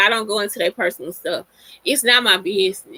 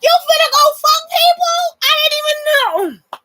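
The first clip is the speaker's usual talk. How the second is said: very high squeaky fake outrage, louder; hammed-up shriek of betrayal, pitched way up; mock shocked squeal; 4 dB louder than usual. To her man, finding a hookup text: mock shocked squeal. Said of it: "I'd be like".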